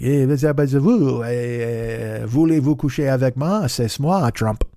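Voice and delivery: imitating French chatter, drawn-out syllables; mock French. Driver mimicking French couple talking in the car, mock French. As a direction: babble in exaggerated pretend French. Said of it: "They were conversing in French".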